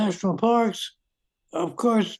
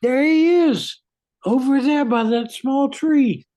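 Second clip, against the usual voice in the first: excited; 5 semitones higher, 4 dB louder than usual. Acted. Spotting the lost boy.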